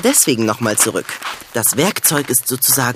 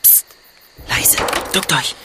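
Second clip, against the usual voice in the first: whispering; 4 dB quieter, slower than usual. Acted. Hiding from a thief.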